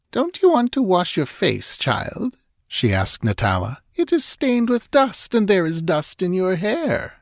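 high, sweetly mocking tone; a hint of malice; faintly mocking. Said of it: "the Stygian's faintly mocking tone".

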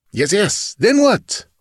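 high, pushing impatience; impatient. Acted. Chief to dying man, impatient push. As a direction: hurry him along angrily. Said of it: "raging with impatience".

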